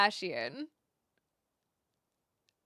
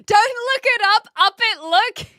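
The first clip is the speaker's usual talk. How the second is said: mock slogan; 9 semitones higher, 9 dB louder than usual. Mocks the slogan.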